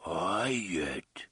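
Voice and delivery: hoarse, whispered creature voice. Acted. The bumbler answers his master, hoarse creature whisper. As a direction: rasp it out like a small beast. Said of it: "Oi replied in a hoarse whisper".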